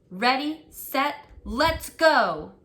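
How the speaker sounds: ordinary delivery, nothing marked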